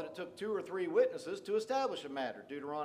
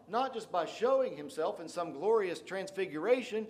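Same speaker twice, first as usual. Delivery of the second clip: ordinary talk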